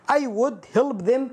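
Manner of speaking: slow, careful reading of the example. Shown as a demonstration. Teacher slowly reads a wrong example sentence. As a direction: read it word by word. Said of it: "من الأخطاء التي يكررها الكثير".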